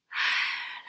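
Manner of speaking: breathy storybook sigh; big sigh. Acted. The giant sighing in the story, a big breathy sigh. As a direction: heave a big sad sigh. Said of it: "he did a big sigh".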